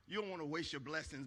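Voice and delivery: normal talking voice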